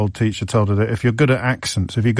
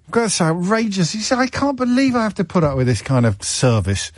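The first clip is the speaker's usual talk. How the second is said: higher-pitched indignation, played up; hammed-up affronted complaint; mock outrage; 7 semitones higher than usual. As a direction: protest as if deeply wronged. Mock outrage at producer's on-screen jibe.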